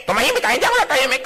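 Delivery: high, fast bickering retort; quarrel reply. Acted. The other spouse snapping the same words back.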